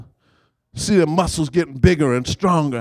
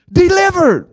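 higher, louder proclamation; proclaimed answer; 10 semitones higher, 6 dB louder than usual. Real, not acted. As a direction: ring out the answer. Call and response answer.

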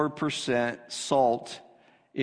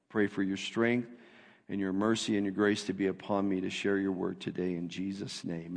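prayer; 5 semitones lower than usual. Real, not acted. Pastor prays before the sermon.

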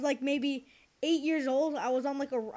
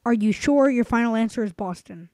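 game show suspense; 3 semitones lower, 8 dB louder than usual. Acted. Quiz host suspense.

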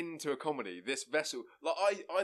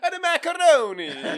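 much higher, loud and slow mock Italian; mock Italian accent; 14 dB louder than usual. Teasing the sitcom Italian's catchphrase, mock Italian accent. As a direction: sing it out like a cartoon Italian. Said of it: "you finish every sentence with".

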